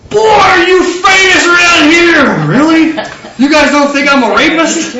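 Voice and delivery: high, loud, drawn-out opening with laughter creeping in; acted amazement. Joseph waking to learn of his fame, hammed-up amazement. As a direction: play it big, astonished and amused. Voices characters at resurrection morning.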